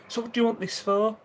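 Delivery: put-on high, prying old lady voice; nosy old lady. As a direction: mimic a nosy shopkeeper grilling you. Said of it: "She went".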